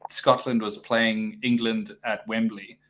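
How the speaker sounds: ordinary delivery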